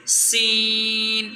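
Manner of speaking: سین with a long hissing s, drawn out very slowly; teacher shows the child the whistling sound of سین; whistled seen. Shown as a demonstration. Teacher hisses سین long and slow for the child. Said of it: "سیٹی کی آواز آئے".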